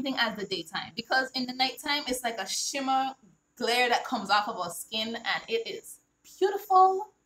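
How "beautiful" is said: high, loud burst on beautiful; soaring praise. Real, not acted. Author praising brown skin, soaring delighted beautiful. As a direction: lift the word up with delight.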